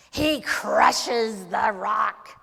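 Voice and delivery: slow, loud, drawn-out storytelling; dramatic crushing. A narrator's reading. Telling how he crushes the rock, slow and forceful. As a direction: crush each word slowly, with great force.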